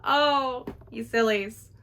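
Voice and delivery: lightly voiced traveller amused at the sillies; amused gentleman. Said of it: "he laughs and he goes".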